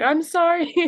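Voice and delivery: high, loud tearful "I'm sorry"; crying apology. Retold or imitated. Apologizing to grandma on the phone, tearful and loud. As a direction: voice cracking, choking out an apology.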